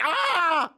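high, loud, drawn-out mock scream; panicked scream. Host imitating the jammed man, high mock scream. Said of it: "he was like".

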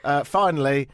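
normal talking voice

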